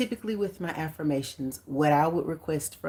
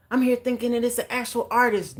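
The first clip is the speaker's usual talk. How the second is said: usual speaking voice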